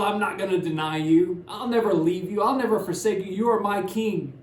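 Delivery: loud, high, fervent declaration; bold vow. Retold or imitated. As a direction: proclaim it with full bold conviction. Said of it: "And he was bold".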